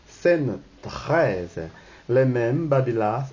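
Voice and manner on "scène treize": normal talking voice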